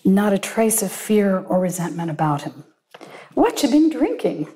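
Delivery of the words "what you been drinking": asked lightly. A narrator's reading. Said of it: "he asked lightly".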